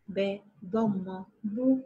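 "ب ضمة بو" drawn out slowly, ending on a long round "بو"; slow sounding out. Shown as a demonstration. Teacher to kindergarten class, sounding out "بو" slowly. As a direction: spell it slowly for the kids, "ب ضمة بو". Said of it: "وتكون صوتها هكذا".

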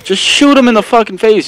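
loud, high yell at the screen; frustrated yell. Real, not acted. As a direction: shout at a useless teammate. Yelling at teammate on screen.

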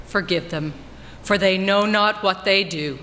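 normal talking voice

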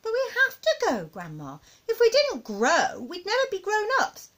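put-on high, earnest child voice; high-pitched boyish objection; protesting little boy. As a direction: plead your case to grandma.

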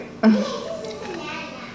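a light breathy laugh, high; high soft laugh. Real, not acted. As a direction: laugh softly at the burn. Laughing at the spicy bite.

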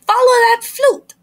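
high, loud call after the flute; yelled. A narrator's reading. Fifi yelling to her friends, a high loud call. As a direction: shout it out to chase the flute. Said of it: "yelled Fifi".

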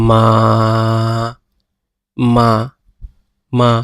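মা said with the Chinese tones one by one; teacher sounding out মা in tones; tone demo. Shown as a demonstration. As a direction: model each tone on মা. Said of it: "উদাহরণের জন্য দেওয়া আছে".

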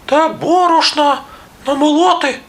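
very high squeaky mouse voice; little mice. A narrator's reading. Mice tell the rooster what's needed, squeaky voice. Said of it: "кажуть".

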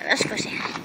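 ordinary talk, nothing marked

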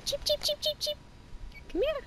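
very high sing-song chick call. Real, not acted. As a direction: coax the chicks over in a tiny high voice. Calling chicks to come over.

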